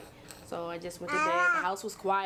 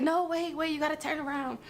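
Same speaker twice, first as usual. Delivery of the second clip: higher and louder, calling up to her on the ladder; urgent call up; 9 semitones higher, 3 dB louder than usual. Retold or imitated. Urgent raised call to the girl on the ladder.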